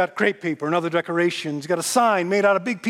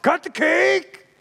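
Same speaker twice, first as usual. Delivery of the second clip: loud, higher, gruff push; gruff urging; 8 semitones higher, 6 dB louder, slower than usual. Retold or imitated. Diner owner covering his discomfort at her tears, gruff shout. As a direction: bark at her to get on with it, slowing at the end. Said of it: "he gruffly, grum-, mumbly".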